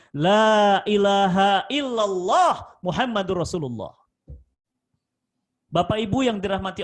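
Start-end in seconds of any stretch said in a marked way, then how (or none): 0.1-3.9 loud, slow, drawn-out 'La ilaha illallah Muhammadur Rasulullah'; fervent shahada. Retold or imitated. Condemned man vows to recite 'La ilaha illallah Muhammadur Rasulullah', loud and slow.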